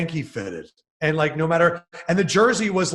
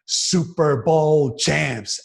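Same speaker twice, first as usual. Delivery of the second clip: loud, slow, drawn-out victory cry; triumphant shout; 5 dB louder, much slower than usual. Retold or imitated. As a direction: call it out slowly to the whole stadium. Shouting on the Jumbotron camera.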